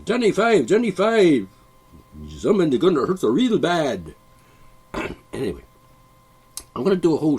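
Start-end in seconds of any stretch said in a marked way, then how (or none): 0.1-4.1 higher, louder hammed-up Indian accent; mock Indian accent, loud and high; Indian accent movie quote. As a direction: belt the movie line in a playful Indian accent. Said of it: "Indian accent".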